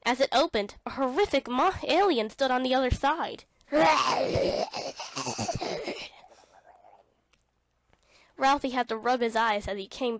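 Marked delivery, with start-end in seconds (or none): 3.7-6.1 unvoiced shriek of a laugh; breathy scared laugh-shout. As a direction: yelp and laugh at the alien. Alien at the door.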